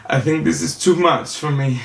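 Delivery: high-pitched tearful apology, imitated; hammed-up weepy voice; mock crying. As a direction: imitate someone apologizing through tears. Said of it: "The person was crying".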